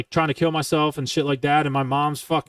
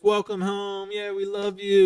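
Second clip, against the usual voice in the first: mock sappy family; 5 semitones higher, slower than usual. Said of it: "goo goo gaga shit".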